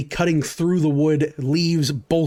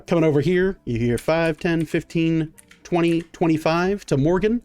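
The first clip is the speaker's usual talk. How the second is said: ordinary delivery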